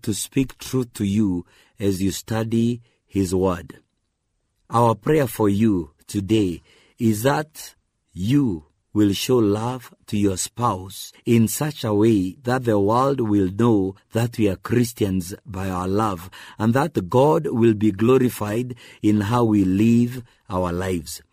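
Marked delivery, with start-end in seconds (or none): none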